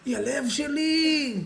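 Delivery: high, slow lovesick wail; hammed-up tearful outburst; mock crying wail. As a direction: wail it like a heartbroken sap. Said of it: "על מה אתה בוכה".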